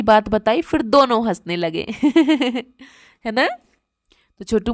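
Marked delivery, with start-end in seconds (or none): 2.0-2.6 short acted laugh, same level as speech; story laugh. Teacher telling children both brothers began laughing, short story laugh. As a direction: laugh along like the two brothers.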